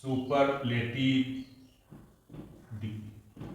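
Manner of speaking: सुपरलेटिव drawn out slowly, डिग्री fading to a whisper; slow model word. Shown as a demonstration. Teacher spells out a term for pupils, slow, fading at the end. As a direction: say सुपरलेटिव slowly and let डिग्री die away.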